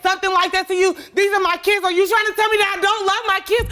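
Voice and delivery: loud, high furious shouting; angry shout. Real, not acted. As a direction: yell at her mother. Shouting at her mother.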